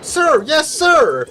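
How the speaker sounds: recruit shout. Acted. Recruit answering drill sergeant.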